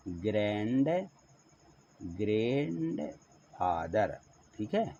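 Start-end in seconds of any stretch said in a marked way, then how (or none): 2.2-4.2 ग्रैंडफादर stretched very slowly and softly; slow model pronunciation. Shown as a demonstration. Teacher slowly saying "ग्रैंडफादर" as pupils write. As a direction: draw out "ग्रैंडफादर" slowly for writing. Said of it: "तो यहाँ लिख देते हैं".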